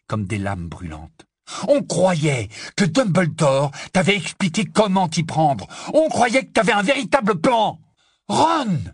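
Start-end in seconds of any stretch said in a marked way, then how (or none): 1.7-7.8 loud outburst climbing very high; furious accusation. Acted. Ron blames Harry for having no plan, furious. 8.3-8.8 high, loud cry of reproach. Acted. Hermione cuts into Ron's outburst, crying out. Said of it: "s'écria Hermione".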